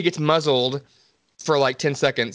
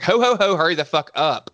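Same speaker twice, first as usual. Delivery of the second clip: higher mock-impatient heckle; acted-out exasperation, pitched up at the start; impatient outburst; 4 semitones higher than usual. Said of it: "And I was like".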